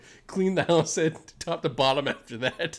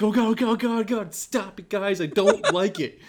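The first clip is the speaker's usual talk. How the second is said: mock frightened pleading, higher and louder; scared pleading; 5 semitones higher, 4 dB louder than usual. Scared friend begging the guys to stop, mock fear. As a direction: imitate a jumpy friend begging them to stop. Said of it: "He's like".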